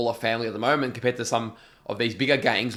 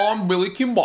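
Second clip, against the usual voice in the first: higher, louder mock gangster accent, words swallowed; Billy Kimber impression; 9 semitones higher, 4 dB louder than usual. Showing cohost how the gangster says it, swallowed accent. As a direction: eat the name in the throat. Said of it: "he's like".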